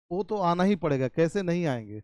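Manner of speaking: normal talking voice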